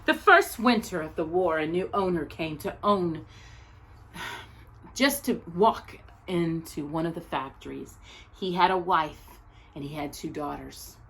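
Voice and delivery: measured, expressive picture-book reading; story narration. Acted. Teacher reading a picture book about Anne Frank's tree to her class, gentle storytelling. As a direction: read it like a storyteller at circle time.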